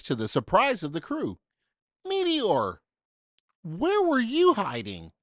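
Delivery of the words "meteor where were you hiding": higher, louder surprised call; surprised commander. Acted. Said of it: "the commander asked".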